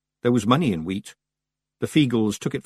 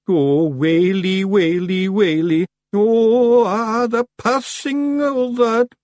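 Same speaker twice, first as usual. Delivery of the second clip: long, loud, high lament; wailing Feegle; 12 semitones higher, 7 dB louder, much slower than usual. A narrator's reading. Lone Feegle despairing aloud, slow theatrical wail. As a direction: draw out each wail, pitched up.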